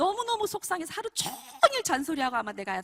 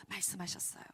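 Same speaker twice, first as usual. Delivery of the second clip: usual speaking voice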